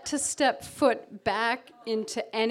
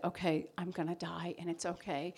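quiet surrender; 4 semitones lower, 9 dB quieter than usual. Retold or imitated. Surrendering to drowning.

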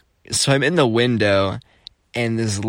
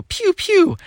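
very high laser-like "pew, pew"; pew pew sound; 3 dB louder than usual. Retold or imitated. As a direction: imitate little zaps in a squeaky high pitch. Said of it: "pew sound".

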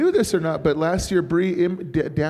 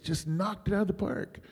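ordinary talk, nothing marked

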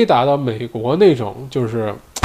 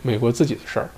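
ordinary talk

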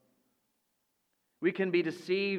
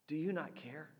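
quiet plea; 9 dB quieter than usual. Acted. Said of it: "the words we often address to God".

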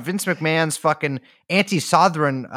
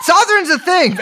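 laughing insistence; 14 semitones higher, 7 dB louder than usual. Real, not acted. Laughing through a joke insistence.

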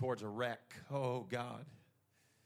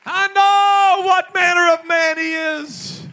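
shouted, high revival sermon; preacher shouting to rouse the congregation; full-force preaching; 24 dB louder, slower than usual. Real, not acted. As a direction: proclaim it in ringing phrases, stretching the last words.

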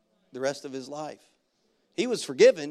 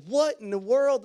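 higher, slow baffled exclamation; exasperated disbelief; 6 semitones higher, 4 dB louder, slower than usual. Retold or imitated. Pastor seeing the fire die out once more, exasperated. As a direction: stretch it out in mock bewilderment.

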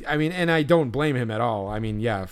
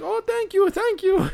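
very high squeaky thanks; old lady voice. Retold or imitated. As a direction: chirp it like a grateful granny. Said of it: "And she's like".

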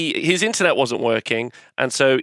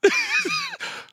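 very high puppet giggle; 4 dB quieter than usual. Acted. Puppet laughs after retort.

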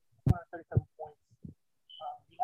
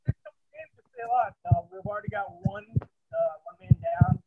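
distant, off-mic voice; sounding far away from the mic; 9 semitones higher, 3 dB louder than usual. Real, not acted. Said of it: "We can't hear you".

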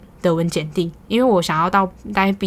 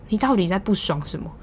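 normal talking voice

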